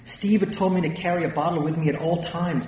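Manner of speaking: usual speaking voice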